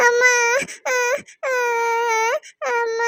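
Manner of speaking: drawn-out sobbing wail, put on; fake crying. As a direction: cry for show so dad hears. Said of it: "அப்பா கேக்குற மாதிரி சத்தமா அழு".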